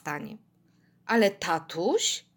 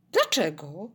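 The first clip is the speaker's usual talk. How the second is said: lower, puzzled question; mild surprise; 4 semitones lower than usual. A narrator's reading. Dad puzzled by daughter's protest, mild surprise. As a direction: ask it with a touch of surprise. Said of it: "zdziwił się".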